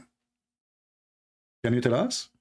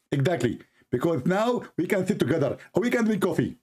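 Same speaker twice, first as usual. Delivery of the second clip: higher, rising mock preacher voice; Zakir Naik impression; 7 semitones higher than usual. Host as preacher, answering, voice climbing higher. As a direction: push it up proudly as the preacher.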